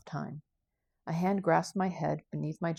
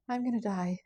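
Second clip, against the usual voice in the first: fearful realisation; 5 semitones higher than usual. Acted. Prisoner panics before execution.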